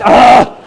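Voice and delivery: loud high ah at a flubbed take; frustrated cry. Real, not acted. [name] reacting loudly to a flubbed line. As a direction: yelp at your own slip.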